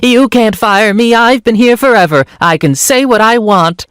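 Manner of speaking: high-pitched, put-on rant; mock screaming. Arguing doctor tap dancing on the table, mock screaming. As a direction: wail it high, like a defiant tantrum. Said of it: "tap dancing while screaming".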